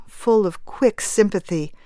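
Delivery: ordinary delivery, nothing marked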